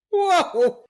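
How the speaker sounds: high, loud startled whoa. Real, not acted. Friend reacts to the rant with a loud, high whoa. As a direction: jump at the friend's outburst.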